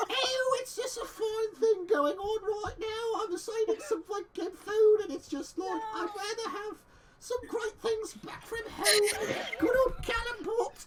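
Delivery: high, slow Australian voice; exaggerated Aussie accent. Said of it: "Can we have Scottish Jess back?".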